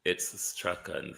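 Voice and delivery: "Strachan" gesprochen wie Strawn, mit langem o. Shown as a demonstration. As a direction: sag "Strachan" rund und kurz, fast wie Strawn. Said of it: "That's how it's pronounced".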